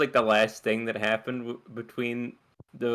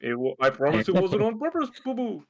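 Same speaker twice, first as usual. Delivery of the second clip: lightly voiced cartoon bear; a hint of the cartoon bear voice; Yogi Bear voice; 9 semitones higher than usual. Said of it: "Yogi Bear with the old man voice".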